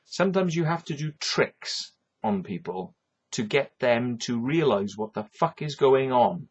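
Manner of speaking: normal talking voice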